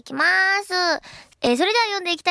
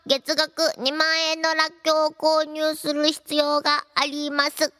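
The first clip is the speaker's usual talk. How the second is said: robotic monotone installer announcement; computer system voice. Acted. Host acts out software installation messages, robotic system voice. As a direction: read it like a machine, evenly pitched and measured.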